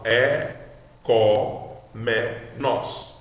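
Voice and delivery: four separate, deliberate syllables. Shown as a demonstration. Teacher divides word into four syllables, said separately. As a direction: count out "É, có, me, nós" with gaps. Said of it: "Quatro sílabas".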